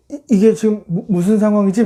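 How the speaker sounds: acted confusion, tripping over the words; flustered. Joseph thrown in the pit, flustered stammer. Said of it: "이런 당황스러움이".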